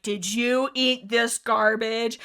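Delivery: slow, drawn-out pretend scolding; not-really-mad telling-off; mock-stern dog owner. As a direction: playfully interrogate the dog, word by word. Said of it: "They're not actually mad".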